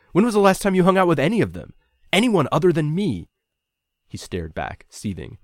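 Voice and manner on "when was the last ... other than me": loud, high, confrontational challenge; pushing. A narrator's reading. Ryan goading Nico about their housemates, loud and accusing. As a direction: press him hard on it. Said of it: "I pushed".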